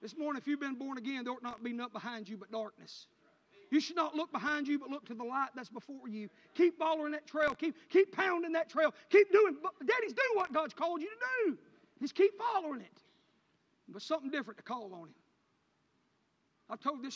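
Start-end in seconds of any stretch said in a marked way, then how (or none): 3.7-13.0 loud, high exhortation, slowing on pounding; full-force preaching. Acted. Preacher urging fathers to keep the trail, loud and rising. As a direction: urge the daddies on, louder each line.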